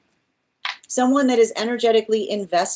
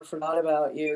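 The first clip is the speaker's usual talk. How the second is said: mock sentimental admirer; 6 semitones lower, 5 dB quieter than usual. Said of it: "energetic admirers energy goes".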